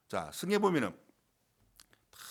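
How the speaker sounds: ordinary talk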